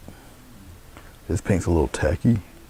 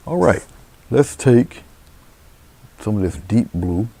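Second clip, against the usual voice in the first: bright, much higher "all right"; upbeat; 6 semitones higher, 8 dB louder than usual. Real, not acted. Painter cheerfully kicking off the next step for viewers.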